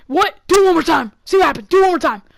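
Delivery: loud, high angry dare; pissed off. Retold or imitated. Scare actor daring the kid, angry shout. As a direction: shout it as a threat, acting him out. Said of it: "like, pissed. Like, he's about to kill someone".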